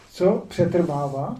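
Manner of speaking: ordinary talk, nothing marked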